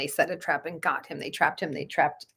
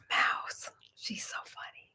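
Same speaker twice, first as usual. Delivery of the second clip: soft whispered aside; whispered fond aside; 11 dB quieter, slower than usual. Real, not acted. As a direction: breathe it so the dog stays settled. Whispering near sleeping dogs.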